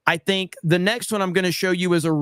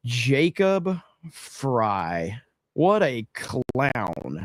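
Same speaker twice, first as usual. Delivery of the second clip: mocking drawl; 5 semitones lower, 3 dB quieter, much slower than usual. Real, not acted. Mocking the mayor.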